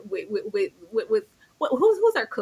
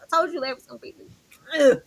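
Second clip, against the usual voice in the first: ordinary talk, nothing marked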